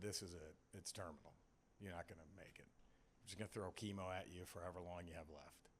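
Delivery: low, quiet grim verdict; deadpan mock doctor, soft and dropped in pitch; bluntly impolite doctor. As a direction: deliver the bad news low and hushed, without courtesy. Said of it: "she was more polite than that".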